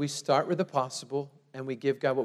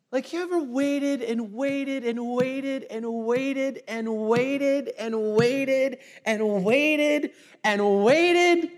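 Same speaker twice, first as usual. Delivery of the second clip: stretched out waiting; 13 semitones higher, 6 dB louder, slower than usual. Acted. Drawn out waiting, stretched words.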